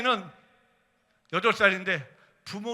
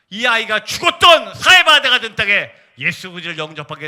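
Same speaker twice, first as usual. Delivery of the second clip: loud, forceful preacher's plea; recounting a prayer with a raised, insistent voice; impassioned prayer; 2 semitones higher, 11 dB louder than usual. Retold or imitated. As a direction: cry out the petition at full force.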